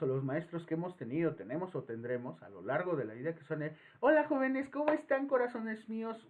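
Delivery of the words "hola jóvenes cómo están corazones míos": much higher, syrupy classroom greeting; a teacher sweet-talking the class like small kids; sugary teacher. Retold or imitated. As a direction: talk down to students like toddlers. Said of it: "como si fueras una criatura de poca edad".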